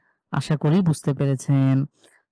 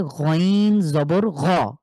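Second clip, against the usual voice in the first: গইন জবর গ spelled out very slowly; slow, careful teacher spelling; much slower than usual. Shown as a demonstration. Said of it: "কোনোভাবেই টানা যাবে না".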